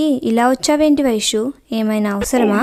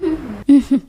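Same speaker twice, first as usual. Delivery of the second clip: risita; 3 semitones higher than usual. Acted. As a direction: giggle at the confusion. Risa ante la confusión de Anji.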